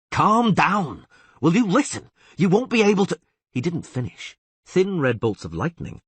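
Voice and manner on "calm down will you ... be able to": high, loud pleading shout; urgent shout. Acted. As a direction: shout to stop her. Geralt pleading with raging sorceress.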